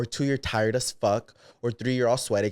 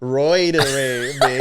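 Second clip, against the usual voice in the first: ordinary talk, nothing marked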